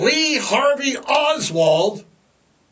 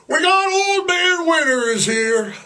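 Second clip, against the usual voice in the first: announcer call; 9 semitones higher than usual. Acted. Announcing winter like a showman.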